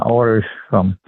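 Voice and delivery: ordinary delivery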